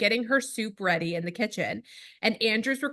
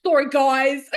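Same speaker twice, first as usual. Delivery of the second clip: put-on 1 sulky toddler; 4 semitones higher, 7 dB louder, slower than usual. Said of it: "she's like".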